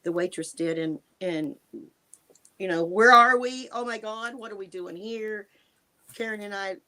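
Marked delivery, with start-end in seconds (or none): 2.8-5.5 bursts out louder, then fades away; retold panic after waking in the diner; panicked. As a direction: jolt awake in panic and trail off. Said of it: "totally lost".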